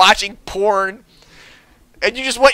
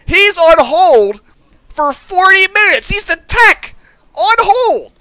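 drawn-out higher repeat of the complaint; slower emphatic retelling; 8 semitones higher, slower than usual. Shown as a demonstration.

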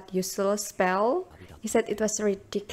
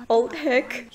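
usual speaking voice

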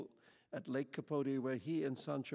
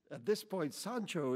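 ordinary delivery, nothing marked

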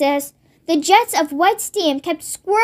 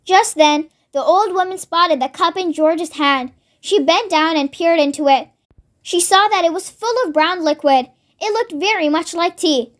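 plain reading voice

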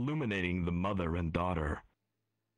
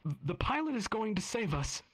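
put-on little girl voice. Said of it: "the daughter says louder".

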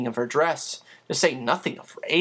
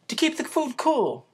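higher, puzzled; puzzled housekeeper; 12 semitones higher than usual. Acted. Becky asks Chris about the icebox, puzzled. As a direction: ask like you've never heard the word. Voice question.

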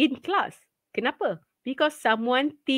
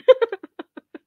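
laugh; 12 semitones higher, 5 dB louder than usual. Real, not acted. Laughs at son mistake.

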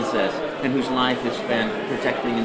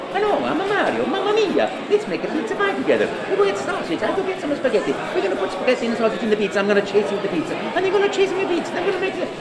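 much higher, bubbly Italian Mario voice; Mario audition voice; faster than usual. Retold or imitated. Improvising at the audition, high excited Mario voice. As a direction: burst into a giddy Italian plumber. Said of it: "I go".

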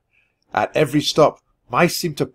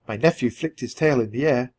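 usual speaking voice

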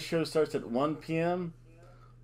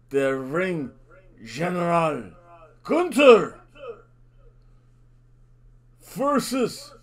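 ring announcer intro; 2 semitones higher, 8 dB louder, slower than usual. Acted. Vlogger introducing the match, booming ring announcer. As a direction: stretch the name out like introducing a fighter.

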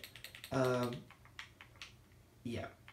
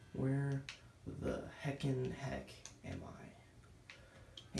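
drawn-out puzzled; 6 dB quieter, much slower than usual. Real, not acted. Lost, drawn-out puzzled question.

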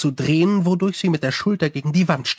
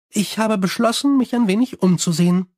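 higher, chatty vampire voice; Sally complaining about her bats, higher and chatty; 5 semitones higher than usual. Acted. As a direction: rattle on cheerfully like a gossiping vampire. Sally answers Angua.

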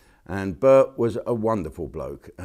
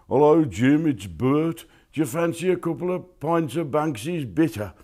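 a light, higher Wolverhampton bloke; lightly voiced friendly old drinking mate; impression of Bert; 8 semitones higher, 3 dB louder than usual. Mimicking late father-in-law inviting him for pints, lightly higher. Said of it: "I'll do an impression of Bert".

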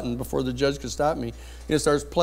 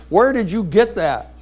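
loud, high, slow demand; mock outraged judge; 6 semitones higher, 6 dB louder, slower than usual. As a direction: drag it out as an angry demand. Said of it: "He says".

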